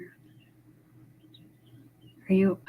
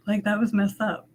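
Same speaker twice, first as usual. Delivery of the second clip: ordinary talk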